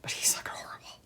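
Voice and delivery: hushed whisper to keep the voice down. Real, not acted. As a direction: whisper it, barely audible. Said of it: "try to keep my voice down".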